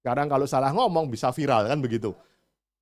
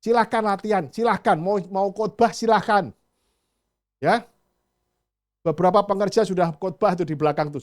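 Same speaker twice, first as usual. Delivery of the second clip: loud, encouraging pulpit call; loud, inviting sermon appeal; urging preaching; 4 semitones higher, 7 dB louder than usual. Acted. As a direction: push the young ones to step up. Pastor urging youth to preach.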